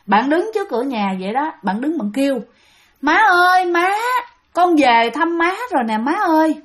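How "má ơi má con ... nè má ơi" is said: calling Mom at the door; a son calling out to his mother from outside the house. Retold or imitated.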